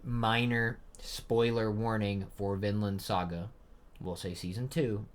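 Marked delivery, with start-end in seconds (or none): 0.0-3.5 slow, measured warning; deliberate repeat. Real, not acted. Host repeats the spoiler warning to viewers, slow and deliberate. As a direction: space out each word so nobody misses it. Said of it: "I'm gonna say it one more time".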